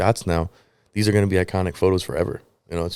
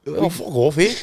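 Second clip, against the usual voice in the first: put-on British accent; 8 semitones higher than usual. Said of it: "You got the British".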